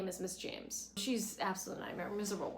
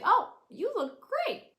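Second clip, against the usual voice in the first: much higher, louder, slow gush; gushing Suki; 9 dB louder, slower than usual. Retold or imitated. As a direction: squeal a compliment. Suki complimenting Lorelai.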